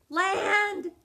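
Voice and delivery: penguin cries land. Acted. Penguin announces landing.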